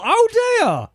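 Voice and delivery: high mock-pitying "oh dear". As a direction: pretend to feel sorry. Mock sympathy reacting to email.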